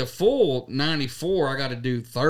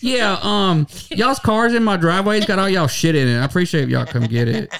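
higher, louder fake-polite message; voicemail voice; 4 semitones higher, 6 dB louder than usual. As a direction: leave a loud, overly polite voicemail. Mock voicemail to neighbours.

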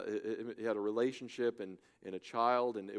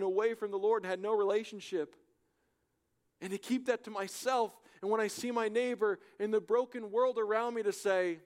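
loud, high, fervent preaching; preaching at full force; 13 semitones higher, 9 dB louder than usual. Acted. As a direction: preach it out to the whole hall, much higher and louder.

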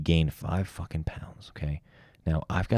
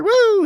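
high cheer; 11 dB louder than usual. Retold or imitated. Cheering as the nail flies.

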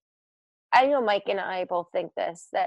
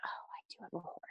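whisper; 25 dB quieter than usual. Real, not acted. Aside whisper about the dog.

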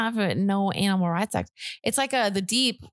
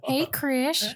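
put-on creepy old man; 4 semitones higher than usual. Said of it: "like the old creepy guy in Family Guy".

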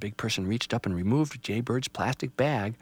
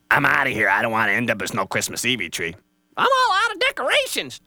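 loud, rushed critter voices; hurried fleeing animals; 7 dB louder than usual. Acted. Forest friends bolt as the family returns, loud and rushed. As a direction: animals scrambling off. Squirrel and Jaybird flee.

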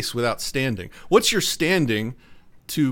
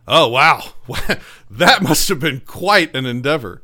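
mock-impressed, louder, with a laugh; sarcastic wow; 5 dB louder than usual. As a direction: feign amazement, laughing at him. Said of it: "The arbitrator would be like".

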